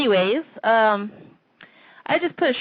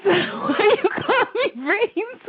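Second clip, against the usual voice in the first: slow question broken by real laughter, higher; laughing question; 11 semitones higher, much slower than usual. Real, not acted. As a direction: laugh through the words as you ask. Teased with a nickname, laughing.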